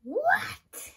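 very high, loud mock shriek; dramatic mom. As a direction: imitate a mother's over-the-top shock. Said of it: "my mom's dramatic".

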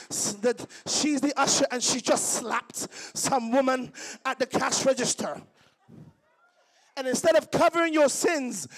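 loud, emphatic sermon delivery; preaching at full force. Acted. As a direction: drive the point home, preaching. Preaching rises loud again.

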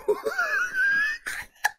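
very high, squealing laugh; helpless laughter. Real, not acted. Viewer cracking up at a video, helpless squealing laughter. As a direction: laugh until it turns into a squeal.

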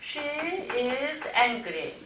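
each word read out slowly and clearly; slow model reading. Shown as a demonstration. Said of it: "한번 보도록 하겠습니다".

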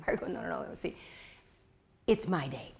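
soft, hushed, tender correction; gentle God voice. Retold or imitated. God tenderly telling Margot the day is His. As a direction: gently set her straight.

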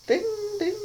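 squeaky high ding sound; ping it out like a bell, very high. Acted. Mimicking a stage guy's wink with a high ding. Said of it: "You just go, ah".

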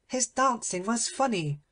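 high, haughty little princess voice; a spoilt princess laughing at the dwarf; mocking princess. Infanta speaks mockingly.

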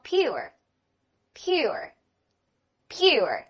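American "pure" with the final r sounded; American accent. Shown as a demonstration. Teacher models "pure" for learners, American accent. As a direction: say "pure" slowly, American style. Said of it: "这是美式的读法".

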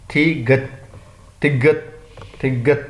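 "تھی گت" in two parts, then "تگت" joined up; letter-name demonstration. Shown as a demonstration. Teacher showing a letter's sound, "تھی گت" split, then "تگت" joined. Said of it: "اس طرح ہے اس کی sound".

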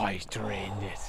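low whispered groan; hushed disbelief. Real, not acted. Golfer watches opponent sink the putt, hushed whisper.

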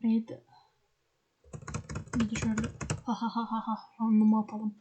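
ordinary talk

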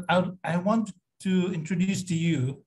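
usual speaking voice

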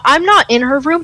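normal talking voice

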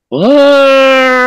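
high, loud mock howl; imitated howl. Retelling the night howls to the host, a high imitated howl. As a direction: yelp it out like a distant howl. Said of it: "You could hear one".

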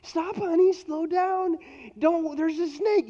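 high, urgent mock warning call; imitating wife's warning. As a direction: play the caring wife calling out. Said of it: "I'm gonna jump off my bike and say".

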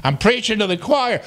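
usual speaking voice

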